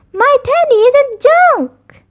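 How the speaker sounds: high, loud, slow little-cub scream. A narrator's reading. Sister screaming at Mama over her teddy, high and slow. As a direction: wail it, clutching for her teddy. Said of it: "screamed Sister".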